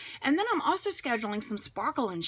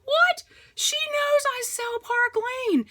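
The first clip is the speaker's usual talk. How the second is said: much higher and louder, slowed indignant disbelief; shocked disbelief; 5 dB louder, slower than usual. Pretend. Seller hearing a friend booked a competitor, shocked squeal. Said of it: "And you're thinking".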